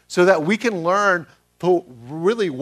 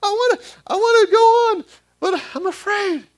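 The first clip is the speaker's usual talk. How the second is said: put-on very high little-boy voice, louder then dropping; child's voice, eager then timid; scared child; 5 dB louder than usual. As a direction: act the son torn between wanting and fear. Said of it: "So he's telling".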